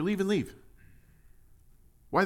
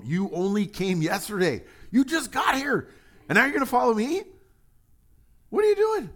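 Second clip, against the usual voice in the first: much higher, louder disbelief; incredulous; 12 semitones higher, 7 dB louder than usual. Retold or imitated. Preacher playing David at the foreigner, incredulous. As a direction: squeal it out like it's absurd.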